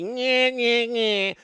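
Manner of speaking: childish mock nyeh nyeh. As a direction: tease him like a playground bully. Mocking crybaby taunt.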